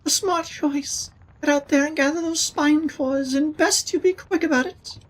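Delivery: a hint of a kindly seamstress. Voices the Seamstress character.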